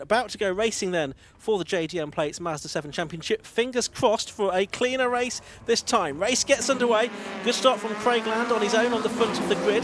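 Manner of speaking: rising, excited play-by-play as the race starts; live race commentary. Acted. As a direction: call the race start with building energy.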